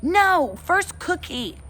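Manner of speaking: put-on high, loud toddler refusal; bratty toddler. Toddler Fudge refusing Janet, high and loud. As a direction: stamp your foot like a stubborn toddler.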